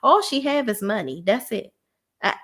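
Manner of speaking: ordinary talk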